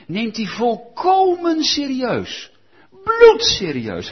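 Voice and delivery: slow, loud emphasis on Bloedserieus; emphatic preaching. Real, not acted. Preacher insisting to the congregation, slow and loud. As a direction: hammer home serieus and Bloedserieus. Preacher stressing Jesus' seriousness.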